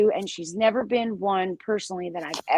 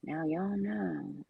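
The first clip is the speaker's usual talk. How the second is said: drawled imitation; 4 semitones lower, 11 dB quieter than usual. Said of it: "it went to".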